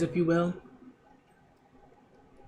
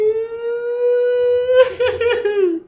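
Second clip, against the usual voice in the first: much higher, louder burst of laughter; high giddy laugh; 6 dB louder than usual. Real, not acted. Hearing the Mephistopheles deal, much higher giddy laugh.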